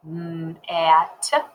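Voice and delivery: "M-A-T-E-R" stretched into mmm, a, t, er; finger-spelled sounds. Shown as a demonstration. Teacher showing the double-letter rule, sound by sound. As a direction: sound out M-A-T-E-R piece by piece. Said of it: "Let's finger spell".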